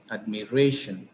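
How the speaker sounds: "admiration" with the weight on RAY; penultimate stress. Shown as a demonstration. Teacher showing where stress falls, stress on RA in "admiration". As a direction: land firmly on the "ra" of "admiration", then fall. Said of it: "fall on the penultimate syllable".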